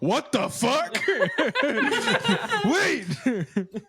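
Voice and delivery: cracking up mid-question; laughing disbelief. Real, not acted. Cracking up at a fan's comment, laughing disbelief. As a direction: laugh through the words in disbelief.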